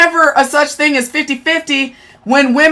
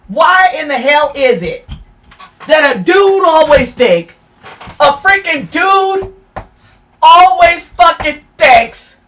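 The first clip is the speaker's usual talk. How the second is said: furious shout; 3 semitones higher than usual. Real, not acted. Streamer raging about men to her chat, shouting. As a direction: spit the words out in outrage. Building angry rant.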